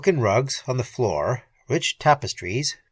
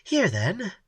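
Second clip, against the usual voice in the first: lightly raised, cool pirate woman; a hint of the cool Red Peri; lightly voiced Peri; 7 semitones higher than usual. A narrator's reading.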